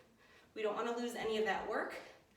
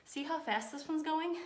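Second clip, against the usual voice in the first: ordinary talk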